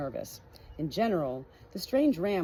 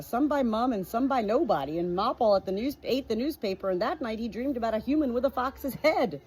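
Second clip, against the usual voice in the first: ordinary delivery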